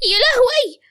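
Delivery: mother-in-law panicking over the fainted daughter-in-law; panicked cry. Acted.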